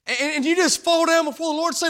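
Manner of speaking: high, loud, driving preacher cadence; full-throated gospel sermon; preaching at full force. Acted. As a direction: preach it out to the whole church. Preaching lead-in to a cry.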